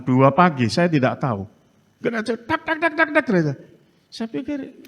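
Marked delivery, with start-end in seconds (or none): 2.6-3.2 high, quick tapped knocks on a door; knocking imitation. Preacher imitating knocking at the church door at night. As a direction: tap it out like knuckles on wood.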